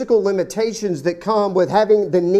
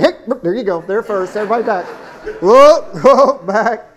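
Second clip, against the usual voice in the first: louder toward look, look back; mock hurried disciples; 6 dB louder than usual. Disciples hustling the crowd back for the kids, hammed-up shout. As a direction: clear the way, half laughing. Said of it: "the disciples ran and said".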